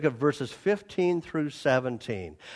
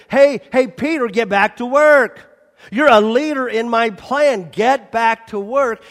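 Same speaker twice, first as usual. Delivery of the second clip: urgent call to work; 5 semitones higher, 7 dB louder than usual. Retold or imitated. Jesus urging Peter back.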